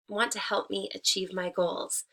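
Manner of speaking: ordinary talk, nothing marked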